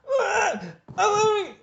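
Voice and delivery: very high, very loud manic yell; manic shout. Retold or imitated. Manic girlfriend yelling love, high and very loud. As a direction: shriek it out in wild mania. She in total insane mania.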